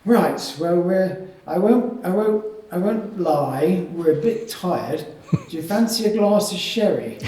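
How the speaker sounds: slow, drawn-out weary don; lightly voiced tired old tutor; weary tutor offering sherry. The tutor greets the late candidate, slow and weary.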